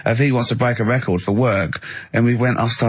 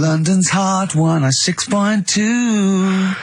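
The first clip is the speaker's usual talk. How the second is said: slow, higher sung-out station ident, like a radio jingle; mock radio jingle; 8 semitones higher, much slower than usual. Co-host interrupting with a rival station's frequency, mock jingle. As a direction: stretch the frequency out like an ad.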